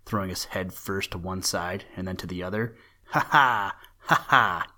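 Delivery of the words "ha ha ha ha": loud teasing ha ha from outside; mocking laugh. A narrator's reading. The bird boy mocking the old rabbit from the window, laughing loud. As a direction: tease him with a cheeky laugh. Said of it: "he went again".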